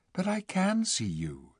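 put-on high, soft girlish plea; earnest insistence. A narrator's reading. Lucy assuring the dwarf, earnest. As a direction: gently insist it is true.

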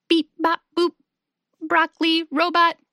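monotone robot, punched word by word; silly robot voice. A narrator's reading. Hugo playing broccoli robot to amuse his friends, silly robot voice. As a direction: play a silly robot, beeping on one note. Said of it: "Hugo makes a silly robot voice".

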